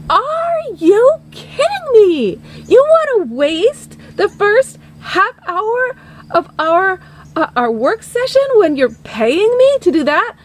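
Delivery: hammed-up exasperated producer; incredulous outrage. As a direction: stretch it out in disbelief, pitch soaring. Producer outraged at her proposal.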